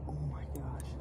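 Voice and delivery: hushed whisper of awe. Real, not acted. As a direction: breathe it out in a whisper.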